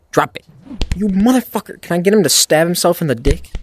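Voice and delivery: ordinary talk, nothing marked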